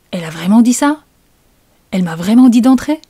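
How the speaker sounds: stunned double-check of what was heard; incredulous. Acted. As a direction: ask it in amazement, swooping upward. Narrator cannot believe the invitation.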